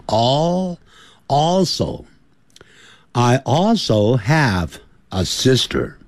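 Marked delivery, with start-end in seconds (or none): 0.1-0.8 "All" held long and higher, tongue to the gum; L sound demo. Shown as a demonstration. Teacher demonstrating tongue on the gum ridge, long high "All". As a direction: draw out "All" into a clear final L. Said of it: "抵到牙龈那个地方".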